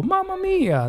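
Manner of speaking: high, hammed-up Italian cry; mock Italian exclamation. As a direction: toss off a cartoonish Italian catchphrase. Mocking exaggerated Italian catchphrase.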